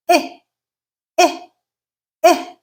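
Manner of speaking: short, isolated eh as in bit; short vowel demo. Shown as a demonstration. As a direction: give eh briefly, three separate times. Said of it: "The next one is this sound here".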